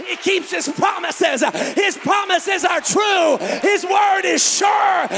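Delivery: fiery revival preaching; full-force preaching. Acted. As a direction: preach it at the top of your lungs to the congregation.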